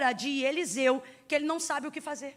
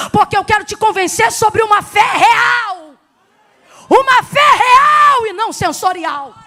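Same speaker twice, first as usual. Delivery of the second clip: full-force preaching; 3 semitones higher, 11 dB louder than usual. Real, not acted. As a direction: build to a ringing shout from the pulpit. (pregação inflamada, em voz alta)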